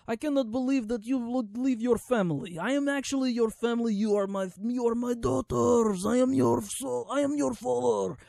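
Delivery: parodying a corny father's speech to his daughters; mocking melodramatic father. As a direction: overact the movie dad pleading. Mocking movie dialogue.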